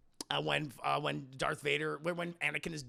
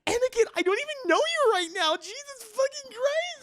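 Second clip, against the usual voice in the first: hammed-up horrified girlfriend; high, loud mock dismay; shocked Padme; 7 dB louder than usual. Padme reacts in quarrel.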